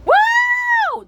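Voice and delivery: very high, long "woo"; squealed thrill-ride whoop. Acted. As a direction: whoop with glee on the way down. Fleur rides down after him.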